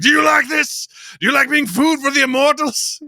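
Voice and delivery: loud, high imitation of an enraged attacker; mock menacing shout. Retelling the film's throat-grab to a friend, mock menacing shout.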